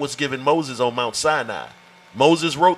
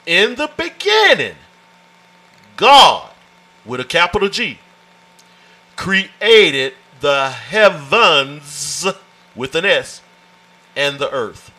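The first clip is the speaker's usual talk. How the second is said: slow, weighty scripture reading; 4 dB louder, slower than usual. Shown as a demonstration. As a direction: read each word as holy text. Reading Genesis aloud in sermon.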